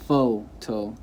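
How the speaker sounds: PHOTO split, FOH stressed, then a lighter toh; stress on first syllable. Shown as a demonstration. Teacher showing the correct way, photo split with FOH stressed. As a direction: say photo in two parts, leaning on FOH. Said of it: "the correct way".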